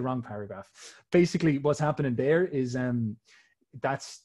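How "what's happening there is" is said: ordinary talk, nothing marked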